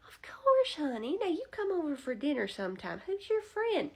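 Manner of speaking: very high, sugary grandma greeting; high doting old-lady voice; sweet old lady voice. Retold or imitated. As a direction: coo at him like a doting granny teller. Teller voiced in retold story.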